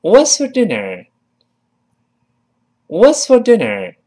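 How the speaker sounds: high, loud model question, falling at the end; read after me. Shown as a demonstration. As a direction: project it for learners to repeat. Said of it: "跟我念". (高音、响亮的领读问句)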